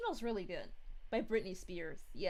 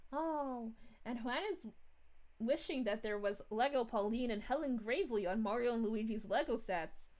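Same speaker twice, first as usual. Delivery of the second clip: ordinary talk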